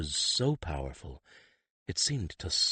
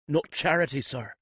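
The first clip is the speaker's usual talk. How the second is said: put-on higher, eager boy in a broad country accent; 9 semitones higher, 3 dB louder than usual. Skiff interrupting the priest in a country accent. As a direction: play the humble country lad. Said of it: "putting on his best country accent".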